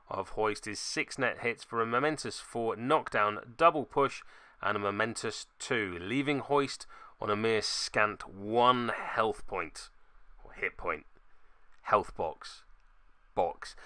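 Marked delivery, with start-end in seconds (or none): none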